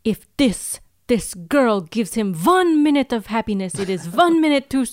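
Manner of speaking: a hammed-up vengeful villain saying vun; Russian accent. The villain demands eternal pain, a hammed-up Russian accent. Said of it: "Why is it "vun"?".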